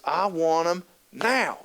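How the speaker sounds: slow, drawn-out pouty demand; little girl demand. Retold or imitated. Girl stomping her foot at mom, slow sulky demand. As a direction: stretch it out like a stubborn little girl.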